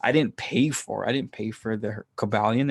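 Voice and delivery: usual speaking voice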